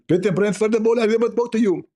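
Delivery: high, fast, lisping mock voice; mocking Zakir Naik. Mocking the rival preacher's denial, high and lisping. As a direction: rush it in a squeaky toothless lisp. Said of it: "You will speak like Zakir Naik".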